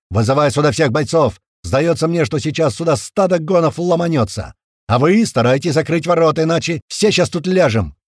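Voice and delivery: loud, high urgent commands; shouted orders. A narrator's reading. Tuman to the technicians as attack looms, shouting. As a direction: bark orders before an attack.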